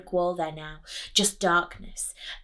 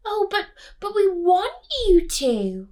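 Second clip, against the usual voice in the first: high, loud, pleading other mother; high sugary other mother, pushing hard; 13 semitones higher, 9 dB louder than usual. Acted.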